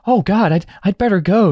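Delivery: high, hesitant mock worry; nervous film character. Newcomer wanting out of the fight, mock nervous. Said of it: "Matt is going like".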